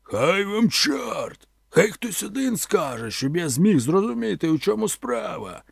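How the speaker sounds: high, slow roar over the din; roaring shout. A narrator's reading. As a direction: bellow so one voice cuts through the noise. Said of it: "він крикнув так, що перекрив весь цей галас".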